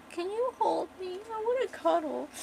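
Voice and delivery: put-on high, soft drunken pleading; tipsy pleading. Drunk woman asks her man to hold her, tipsy pleading. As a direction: plead softly in a small high voice.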